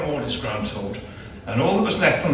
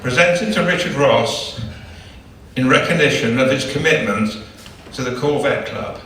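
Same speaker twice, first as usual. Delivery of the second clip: reading the engraving; 5 dB louder than usual. Acted. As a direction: announce the inscription with ceremony. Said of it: "this one says".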